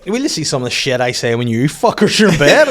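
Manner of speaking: an adult insisting on his rights; mock indignant dad. Dad defending swearing to his kids, mock indignant loud. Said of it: "I have to be like".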